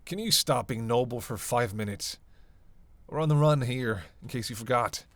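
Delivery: loud, fed-up rebuke; exasperated scolding. Acted. Reminding partner they're on the run, exasperated scolding. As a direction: scold with punched key words.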